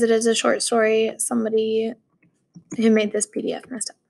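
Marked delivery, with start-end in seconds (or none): 2.8-4.0 under-breath whisper about the file; whispered aside. Real, not acted. Reader whispering an aside about the broken PDF.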